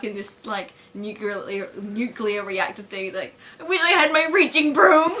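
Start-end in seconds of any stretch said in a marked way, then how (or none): 3.6-5.1 high, loud cartoon whine; hammed-up cartoon lament; Homer. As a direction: wail it in a put-on voice. Homer stuck, wishing.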